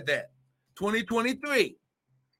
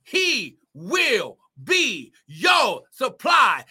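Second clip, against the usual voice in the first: second take, slowed right down and shouted; drawn-out repeat; 3 semitones higher, much slower than usual. Real, not acted. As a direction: stretch each word out for emphasis. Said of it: "I'm gonna say it again".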